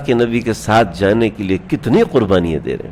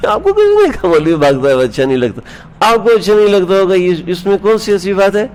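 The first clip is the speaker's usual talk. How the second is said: indignant retort; 8 semitones higher than usual. Acted. Preacher rebuts the complaint.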